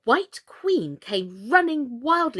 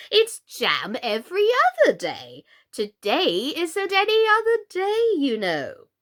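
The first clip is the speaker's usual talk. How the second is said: higher, louder queen voice; 9 semitones higher, 7 dB louder than usual. A narrator's reading. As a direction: press the silly logic home.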